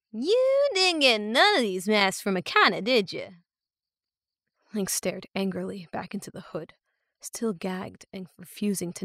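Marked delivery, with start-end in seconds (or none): 0.1-3.4 put-on loud rural-accented questioning. A narrator's reading. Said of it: "The stranger's rural accent".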